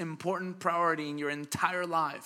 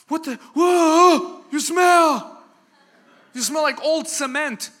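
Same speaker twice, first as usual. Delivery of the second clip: much higher, much louder, slow hammed-up yell of disgust; mock startled shout; 10 semitones higher, 11 dB louder, slower than usual. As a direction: bellow like a coworker hit by the stink. Coworker recoils at construction smell.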